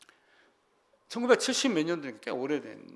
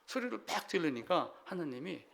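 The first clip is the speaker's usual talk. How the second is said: ordinary talk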